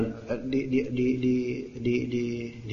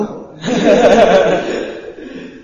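loud high burst of laughter; laughing; 7 semitones higher, 7 dB louder than usual. Real, not acted. Ustadz laughing at his own joke, loud laugh. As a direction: break into a laugh.

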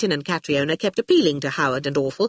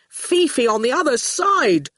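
higher, emphatic grievance; exasperated proclamation; 7 semitones higher than usual. A narrator's reading. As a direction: declaim it like a betrayed man. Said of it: "he proclaimed".

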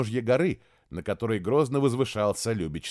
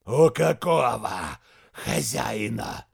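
slow hissing menace; snakelike hiss; 3 dB louder, much slower than usual. Acted. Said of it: "он скорее напоминал шипение змея".

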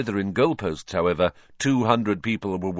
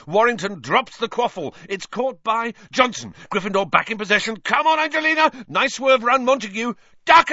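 high, loud fast commentary; excited play-by-play; 13 semitones higher, 6 dB louder than usual. A narrator's reading. Lee Jordan commentating the match, excited play-by-play. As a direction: call the action as it happens.

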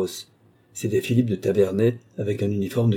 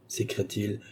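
ordinary delivery, nothing marked

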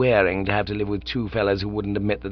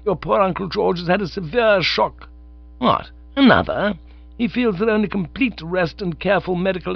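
a lightly voiced stern, formal aunt; Aunt Agatha's voice; 9 semitones higher than usual. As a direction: read it as a prim aunt laying down the law. Aunt Agatha's letter-style lecture.

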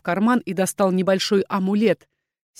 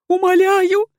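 high, loud, trembling plea; terrified cry for mercy; frantic begging; 12 semitones higher, 6 dB louder, slower than usual. A narrator's reading. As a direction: beg wildly, pitched way up. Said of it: "затряслась она в неистовой дрожи".

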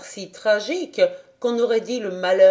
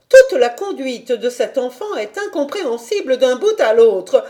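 higher and much louder; indignant governess; 6 semitones higher, 6 dB louder than usual. A narrator's reading. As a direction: raise the voice in outrage.

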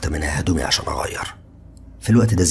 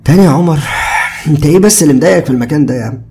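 higher, louder exasperated friend; fed-up Ziad; 10 semitones higher, 8 dB louder than usual. A narrator's reading. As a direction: sigh it out, annoyed at being pestered. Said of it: "هز دماغه بزهق".

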